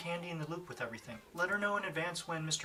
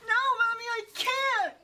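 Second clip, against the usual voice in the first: put-on very high, loud, pleading little kid; a child begging mom to stay; whining child; 8 dB louder than usual. Child refuses to let mother go.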